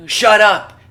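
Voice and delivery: high, very loud shout; shouted catchphrase. Acted. Podcaster echoing his friend's college saying, shouted. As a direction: yell it to cut them dead.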